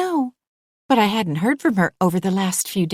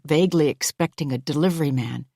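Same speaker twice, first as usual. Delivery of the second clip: ordinary delivery, nothing marked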